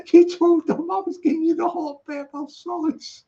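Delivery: high-pitched mock dad; imagined indignant dad. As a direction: play the exposed dad, pitched well up. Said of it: "Can you imagine Harlan's, uh, Harlan's dad going".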